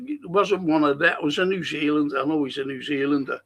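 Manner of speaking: usual speaking voice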